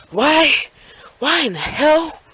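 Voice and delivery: high, drawn-out dismayed why; freaked out. Retold or imitated. Boy in the cop car, freaking out.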